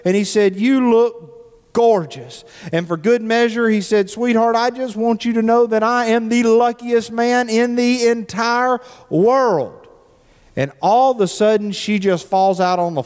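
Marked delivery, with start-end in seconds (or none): 0.6-2.4 drawn-out, lovestruck praise; slow romantic compliment. Retold or imitated. Husband wooing his wife, slow and lovestruck. 4.1-9.9 drawn-out, lovestruck praise; slow romantic compliment. Retold or imitated. Husband wooing his wife, slow and lovestruck.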